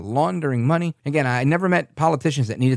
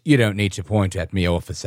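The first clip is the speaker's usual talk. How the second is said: lower, imitated British complaint; mock British accent; 6 semitones lower than usual. Imitating the candidate scolding the officer, lower British accent. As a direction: imitate a haughty British driver, pitched down. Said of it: "he's got this heavy British accent".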